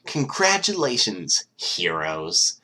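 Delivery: slow, loud mocking praise; sarcastic congratulations. Mastermind mocks the heroes while slow clapping, sarcastic. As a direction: drag out the congratulations with contempt. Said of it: "He slow clapped".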